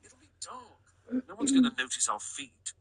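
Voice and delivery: high, quick character dialogue; story character. A narrator's reading.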